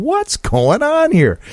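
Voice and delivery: baffled. Retold or imitated. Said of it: "and I'm going".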